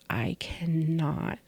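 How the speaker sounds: whispered hopeless inner voice; despairing whisper. Retold or imitated. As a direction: breathe the words out, broken. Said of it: "all I could think about was".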